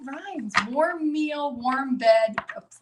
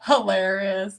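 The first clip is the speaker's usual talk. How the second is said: normal talking voice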